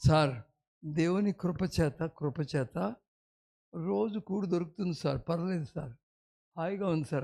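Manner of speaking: a hint of a humble poor man. Preacher quoting a poor man, softly and meekly. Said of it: "ఆయన అంటాడు".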